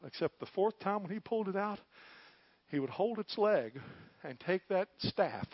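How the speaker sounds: ordinary delivery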